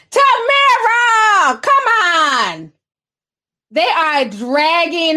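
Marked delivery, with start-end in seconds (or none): 0.1-2.7 drawn-out, loud and high exasperated call; slow, loud cry of disbelief at her; scolding a friend who said something tacky, exasperated. Real, not acted.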